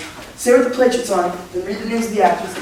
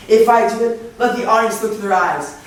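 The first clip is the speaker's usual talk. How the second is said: usual speaking voice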